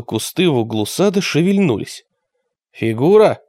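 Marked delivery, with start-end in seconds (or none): none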